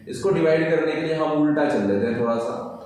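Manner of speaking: usual speaking voice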